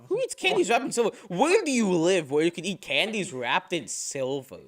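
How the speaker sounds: incredulous. Real, not acted. Incredulous at odd question.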